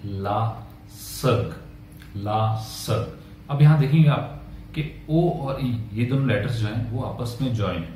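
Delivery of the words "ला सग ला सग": ला सग drawn out slowly, with a soft throaty ग; French model word. Shown as a demonstration. Teacher modelling la sœur, slow and soft. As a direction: say ला सग slowly, sounding like a French speaker. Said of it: "French में कहते हैं".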